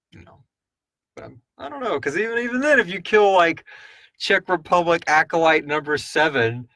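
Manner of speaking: normal talking voice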